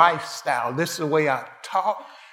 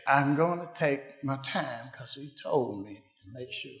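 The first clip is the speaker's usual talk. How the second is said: quiet quoted Jesus; 2 semitones lower, 8 dB quieter than usual. Acted. Quoting Jesus softly.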